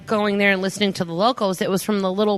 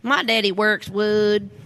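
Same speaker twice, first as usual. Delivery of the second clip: put-on slow, stretched Alabama accent; young Forrest's slow Alabama drawl; slower than usual. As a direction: stretch every word like a boy from Alabama. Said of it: "everything was elongated".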